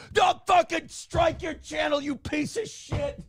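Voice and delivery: high, slow mock furious shout; hammed-up rage at a small insult; mock furious outburst. Mocking the writer overreacting to criticism, hammed-up furious shout. Imitating Eric July's angry reaction.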